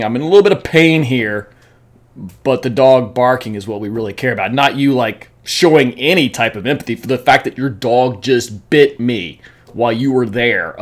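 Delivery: retold sarcastic anger. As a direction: mimic telling off the owner, cutting. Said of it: "I was so mad".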